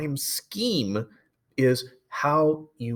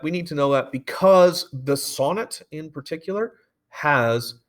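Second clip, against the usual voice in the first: ordinary talk, nothing marked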